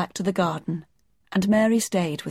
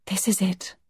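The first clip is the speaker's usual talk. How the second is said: breathy. A narrator's reading. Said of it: "breathed Mary".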